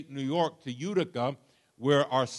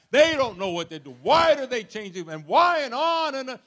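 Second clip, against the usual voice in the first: louder retold rant, climbing higher; tirade; 6 semitones higher, 7 dB louder than usual. Father reliving his rant about the army, louder and higher. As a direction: hammer out the grievance, rising in pitch. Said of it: "I went into a tirade".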